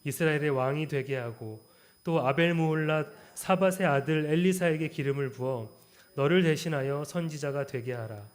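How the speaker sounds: loud, measured Bible reading; scripture reading. Acted. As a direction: proclaim the verses to the congregation. Reads scripture aloud in sermon.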